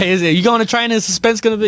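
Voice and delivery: high, quick quote broken by laughter; retelling the joke while cracking up; laughing quote. Retold or imitated.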